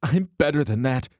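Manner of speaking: loud, high, voice breaking; voice cracking. Acted. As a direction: let the voice crack with tears. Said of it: "Her voice cracked".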